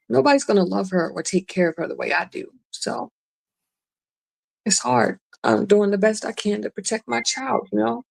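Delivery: holding back tears, pitch up; teary-eyed. Real, not acted. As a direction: voice tight, close to tears. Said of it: "I'm getting a little teary-eyed too".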